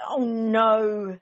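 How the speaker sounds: loud fed-up oh no; exasperated groan. Acted. Mister Bear exasperated.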